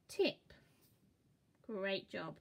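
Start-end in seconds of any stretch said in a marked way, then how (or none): 0.1-0.5 "Tip" blended into one word, lifted. Shown as a demonstration. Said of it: "Let's try again".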